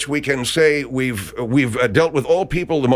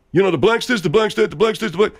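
mock whiny complainer; 5 semitones higher than usual.

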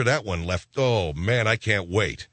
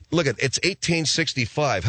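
usual speaking voice

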